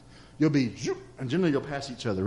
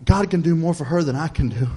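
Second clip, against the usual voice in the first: ordinary talk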